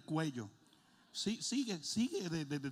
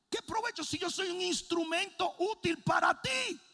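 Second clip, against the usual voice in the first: high, loud preaching cry; full-force preaching; 13 semitones higher, 5 dB louder than usual. Acted. Pastor crying out to God before the church, high loud preaching. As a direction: pour it out from the pulpit.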